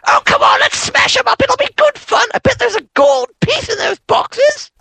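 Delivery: put-on tempting child. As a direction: squeal it like a mischievous brat egging you on. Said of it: "a child comes running up to you and goes".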